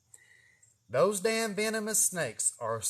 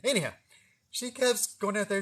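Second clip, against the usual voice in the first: usual speaking voice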